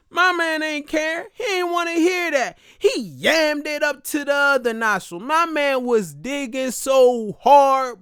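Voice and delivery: ordinary talk, nothing marked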